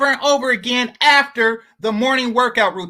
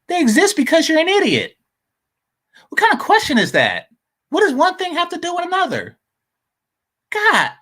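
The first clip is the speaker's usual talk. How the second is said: exasperated scoff; 7 semitones higher, faster than usual. Real, not acted. Scoffing at a clip.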